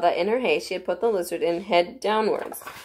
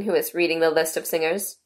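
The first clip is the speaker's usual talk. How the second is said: usual speaking voice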